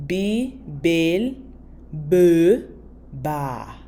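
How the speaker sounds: Bibel beba broken into "bee... bel... buh... baah"; syllable by syllable. Shown as a demonstration. Teacher models two cups for learners, slowly, syllable by syllable. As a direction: draw out each syllable of Bibel beba with gaps between.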